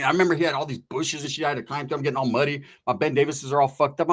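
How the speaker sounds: ordinary talk, nothing marked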